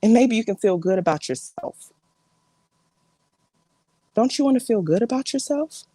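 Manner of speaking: exasperated family confrontation with big swings in pitch; fed-up relative confronting drama-seeker. Acted. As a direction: scold an attention-seeking relative, pausing between jabs.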